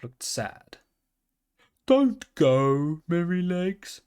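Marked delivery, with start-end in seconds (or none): 1.9-4.0 pleading calf. A narrator's reading. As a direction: gently beg him not to leave. Calf begs the pony to stay.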